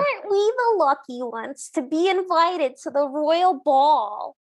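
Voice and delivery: nasal Brooklyn sister voice, sing-song; Brooklyn accent. Acted. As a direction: play a snooty Brooklyn stepsister. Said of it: "You're so good at that".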